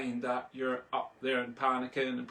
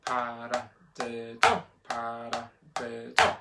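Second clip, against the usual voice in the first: paradiddle chanted slowly, last syllable punched; slow chant landing hard on the end of paradiddle; accent on fourth syllable; slower than usual. Shown as a demonstration. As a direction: hit the final syllable of each paradiddle. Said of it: "let's tackle accent number four".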